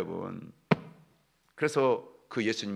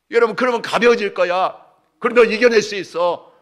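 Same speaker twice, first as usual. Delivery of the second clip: high, loud preacher's cry; full-force preaching; 10 semitones higher, 9 dB louder than usual. Acted. Preacher exhorting the congregation. (힘차게 외치는 설교)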